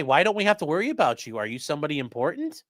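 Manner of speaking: normal talking voice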